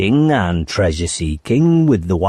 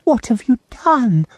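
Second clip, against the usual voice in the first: put-on high, reassuring girl voice; a girl consoling a friend; reassuring young girl, higher; comforting Lucy. A narrator's reading. Lucy asks.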